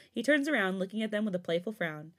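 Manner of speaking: usual speaking voice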